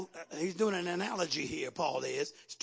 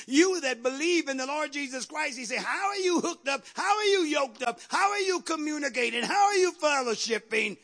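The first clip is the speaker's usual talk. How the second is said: high, raised preacher's voice; challenging preaching; 8 semitones higher, 9 dB louder than usual. Real, not acted. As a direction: press each question on the congregation.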